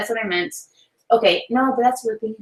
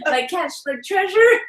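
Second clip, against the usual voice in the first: higher, slow mock pirate; 8 semitones higher than usual. Wife improvising a sign-off for viewers, playful pirate. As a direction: giggly attempt at pirate talk. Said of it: "talking like a pirate".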